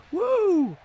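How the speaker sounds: shrill high-pitched woo shout; high falsetto woo. Pretend. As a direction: belt a high woo like the entrance theme. Entrance woo.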